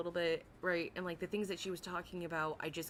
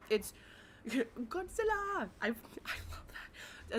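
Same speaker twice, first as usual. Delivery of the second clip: delighted; 5 semitones higher, much slower than usual. Real, not acted. Delighted at Godzilla picture.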